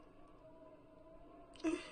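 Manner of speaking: breathy broken laugh through tears; a laugh that collapses into crying; bitter sob-laugh; choked laugh-sob. Acted. Breaks down over lost partner.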